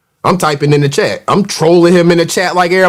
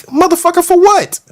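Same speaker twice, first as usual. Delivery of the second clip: high-pitched mock-indignant retort; high, incredulous challenge; incredulous comeback; 11 semitones higher than usual. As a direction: fire back way up high, baffled. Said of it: "That was my response in the chat".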